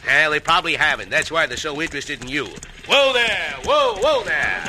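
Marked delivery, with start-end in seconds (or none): 2.9-4.6 calling whoa to horses. Acted. Reining in the horses.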